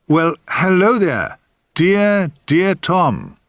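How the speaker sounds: high, drawn-out, swooping greeting; challenging inflection. A narrator's reading. Woman at the bar greets an old acquaintance, with challenging inflection. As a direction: greet with a teasing 'guess who I am' challenge. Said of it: "said with that challenging inflection".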